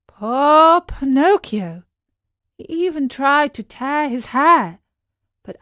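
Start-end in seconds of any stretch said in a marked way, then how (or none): none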